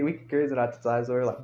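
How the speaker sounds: plain reading voice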